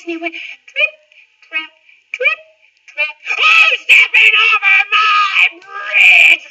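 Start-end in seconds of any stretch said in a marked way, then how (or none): 0.3-3.1 high, light little patter; teeny tiny steps. Acted. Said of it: "little noise like this". 3.4-6.4 slow, loud booming troll; roaring troll. Acted. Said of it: "roared the troll".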